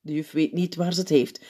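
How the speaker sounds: ordinary delivery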